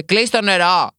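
dad yelling at a kid to get out of the shower, loud mock yell; retold shouting father. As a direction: bark it like a dad yelling through the bathroom door. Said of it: "που μου φώναζε".

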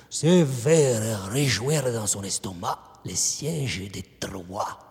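ordinary talk, nothing marked

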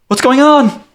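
very high, loud character voice; Tandy calling out. Acted. Tandy arriving through rain and wind, high loud call. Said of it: "and Tandy says".

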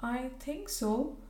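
a sad reluctant admission; quiet sorrowful reply, drawn out; sadly. A narrator's reading. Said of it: "said Bella Clayton sadly".

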